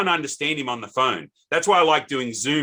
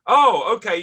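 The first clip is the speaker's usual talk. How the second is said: high, louder dawning "oh"; retold realisation; 9 semitones higher, 7 dB louder than usual. Host imitating the caller learning it's Australia, sudden realisation. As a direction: imitate someone suddenly catching on.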